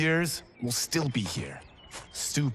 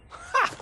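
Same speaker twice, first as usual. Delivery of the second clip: sharp, high mocking laugh; a high scoffing ha. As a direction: laugh off the challenge. Scoffing at friend's boast.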